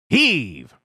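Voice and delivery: loud command; called out. A narrator's reading. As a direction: call the heave. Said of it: "called the fat controller".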